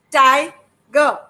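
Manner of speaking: normal talking voice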